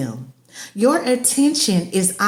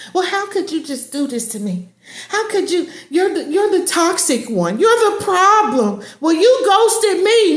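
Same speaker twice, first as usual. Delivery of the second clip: mock guilt-tripping narcissist; 6 semitones higher than usual. Narcissist's guilt trip voiced.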